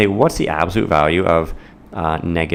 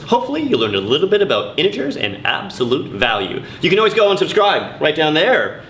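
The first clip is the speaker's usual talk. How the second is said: high, bright outro announcer read; show sign-off; 10 semitones higher than usual. Acted. Host signing off Math with [name], announcer voice. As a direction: wrap up the episode like a host.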